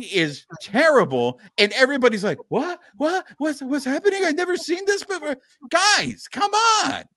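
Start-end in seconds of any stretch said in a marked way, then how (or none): 2.5-5.3 high-pitched, clueless fan astonishment; mock bewilderment. Host mimicking fans shocked by bad pitching, mock bewildered. Mocks fans' surprise at predictable failure. 5.7-7.0 high, loud-ish exasperated plea; pleading in disbelief at their naivety. Real, not acted. Host scolding fellow Dodger fans, exasperated.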